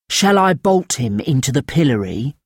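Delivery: ordinary delivery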